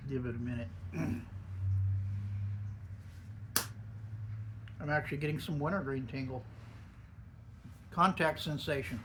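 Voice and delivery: talking around a lip of snuff; speaking with dip tucked in lip; lip full of dip. Real, not acted. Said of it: "even with me talking".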